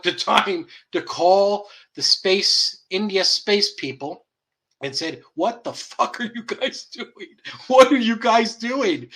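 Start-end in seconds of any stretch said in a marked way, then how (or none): 5.3-9.1 high-pitched, slow exasperated demand; hammed-up disbelief, stretching the question out; mock incredulous caller. Caller demanding answers from India's space people, mock incredulous.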